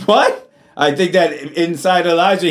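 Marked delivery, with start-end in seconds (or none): 0.1-0.5 a sharp, high "What?" that shoots up; startled high question; astonished. Real, not acted. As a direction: let the disbelief jump out. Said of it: "preacher's own astonished outburst".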